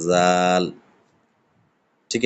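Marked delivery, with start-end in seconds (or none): 0.1-0.6 জাল with light air off the tongue tip; Arabic dhal demo. Shown as a demonstration. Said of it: "হালকা হাওয়া দিয়ে বের করতে হবে".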